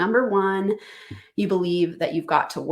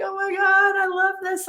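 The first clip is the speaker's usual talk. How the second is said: high, gushing "Oh my God, I love this"; emotional delight; 12 semitones higher than usual. Retold or imitated. Coach retelling her reaction to a client video, high and gushing. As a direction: squeal it out, moved to tears. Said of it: "And I started crying".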